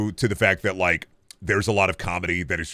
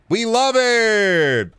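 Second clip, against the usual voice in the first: very high, slow, loud mock delight; sarcastic cheer; 12 semitones higher, 4 dB louder, much slower than usual. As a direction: stretch it out in fake joy.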